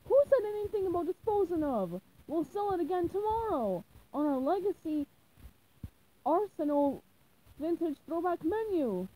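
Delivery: shocked at the waste; stingy boss aghast. Acted. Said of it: "gasped in disbelief".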